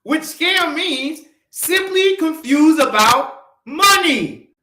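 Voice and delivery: deliberate acronym reveal; emphatic. Real, not acted. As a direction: spell out the acronym slowly. Spelling out scam acronym.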